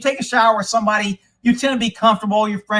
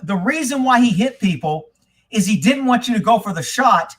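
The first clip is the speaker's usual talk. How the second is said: ordinary talk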